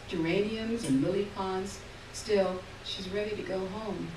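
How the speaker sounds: ordinary delivery, nothing marked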